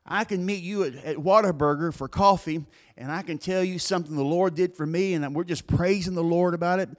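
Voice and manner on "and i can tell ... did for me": normal talking voice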